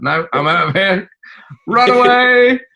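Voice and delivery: high, loud comic panic; mock terror. Host imitating a lady fleeing the theatre, mock terror. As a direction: imitate a terrified audience member fleeing, rising to a cry. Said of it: "a lady in sheer terror".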